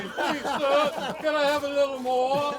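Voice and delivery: begging orphan. Retold or imitated. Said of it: "that's what Freddie will say".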